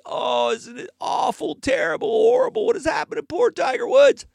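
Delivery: high, loud hammed-up wail; mock wailing lament. Host mocks fans mourning Tiger's crash, hammed-up loud wail. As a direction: overplay a grieving mourner. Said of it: "cry and moan".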